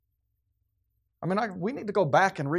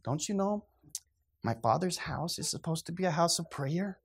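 softer, limp and gentle rebuke; a meek, toothless scolding; mock effeminate Jesus; 8 dB quieter than usual. Mocking a timid Jesus in the temple, soft. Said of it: "he's like".

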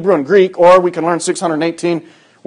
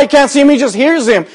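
normal talking voice

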